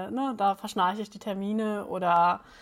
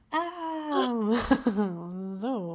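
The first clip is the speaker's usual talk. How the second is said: ordinary delivery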